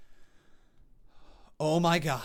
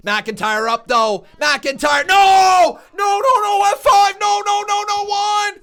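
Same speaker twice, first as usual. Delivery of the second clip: excited play-by-play, much louder and higher; wrestling commentator; 9 semitones higher, 10 dB louder, slower than usual. Real, not acted. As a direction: call the kickout like it's history. Calling the near fall live.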